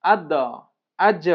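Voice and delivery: আদ and আজ each with a bounced final consonant; আদ, আজ said separately with rebounding endings; qalqalah echo. Shown as a demonstration. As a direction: pop the d of আদ and j of আজ into tiny echoes. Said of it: "এইভাবে করে".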